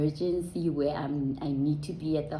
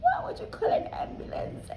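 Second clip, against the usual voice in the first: mock out-of-breath protest, voice thinning out; weak, wheezy mock scolding; imitating breathless indignation; faster than usual. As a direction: act cheeky while struggling for air. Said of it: "I just couldn't breathe".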